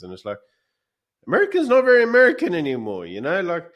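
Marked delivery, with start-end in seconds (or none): 1.3-3.0 much higher, louder and fast exclamation; emphatic outburst. Real, not acted. Mocking friends desperate for America, loud emphatic outburst. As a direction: blurt it out like a punchline. Punchline to friends' emigration dreams.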